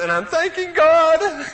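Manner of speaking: high, drawn-out mock wail; loud imitation of a tearful girl; imitated sobbing. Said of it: "she's sobbing away".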